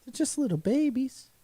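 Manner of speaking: high-pitched playful coo; mock baby-talk squeal. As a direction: coo over them like tiny babies. Cooing over tiny eggs.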